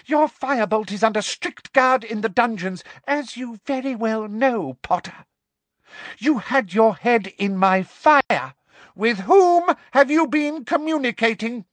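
high, forceful schoolmistress voice; angry interrogator. Acted. Teacher grills captive about the fire, angrily. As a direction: build the interrogation to a loud, high demand.

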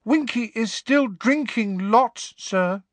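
very high squeaky elf, slow and sad; sadly. A narrator's reading. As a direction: let the little voice sag with sorrow. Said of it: "he said sadly".

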